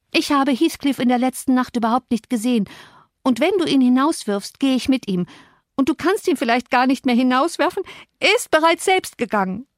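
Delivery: high, distraught defiance; upset, high-voiced outburst; near sobbing. A narrator's reading. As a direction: protest on the verge of tears, pitch climbing. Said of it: "Sie schluchzte bitterlich".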